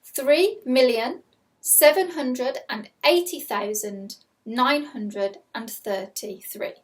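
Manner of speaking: slow, clear dictation of a number; slow school dictation. Shown as a demonstration. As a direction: read it out slowly for pupils. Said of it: "Here is your first number".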